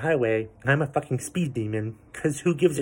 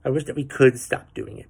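usual speaking voice

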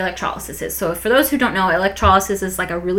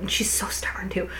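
whispered aside; 9 dB quieter than usual. Real, not acted. Whispered aside about stubborn mom.

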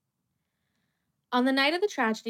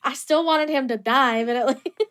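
laughing while talking; 2 semitones higher, 8 dB louder than usual. Real, not acted. Laughing at own morbid joke.

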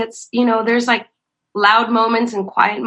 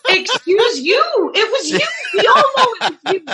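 mock accusing; 11 semitones higher than usual. Narrator calling out the person in front, mock accusing. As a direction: turn it back on them. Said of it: "And I was like".

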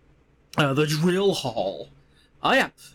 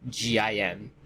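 ordinary delivery

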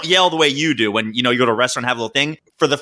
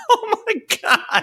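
laughing; slower than usual. Real, not acted. Laughing at friend's drunk remark.

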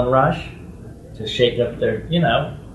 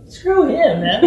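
mock defiance; 11 semitones higher, 4 dB louder, slower than usual.

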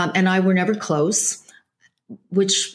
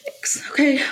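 tearful laugh; 4 dB quieter, much slower than usual. Real, not acted. Tearful laugh after host's comfort.

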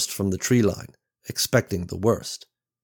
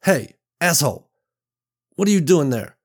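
loud, higher confrontational challenge; trying to sound menacing; 8 semitones higher, 5 dB louder than usual. Acted. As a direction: act tough to scare him. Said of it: "tried to appear menacing".

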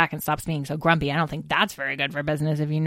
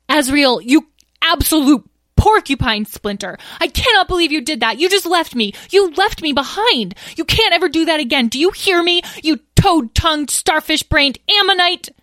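high, loud, furious scolding; tearful outburst; 11 semitones higher, 7 dB louder than usual. A narrator's reading. Petra berating the brother who left, upset tirade. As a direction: berate someone who abandoned you. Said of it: "Was Petra crying?".

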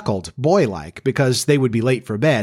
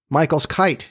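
ordinary delivery, nothing marked

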